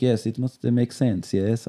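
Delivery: mock a French Canadian director in a vague accent. Joking to his friend about Villeneuve, a vague mock French accent. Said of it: "I don't know what accent that was".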